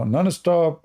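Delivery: usual speaking voice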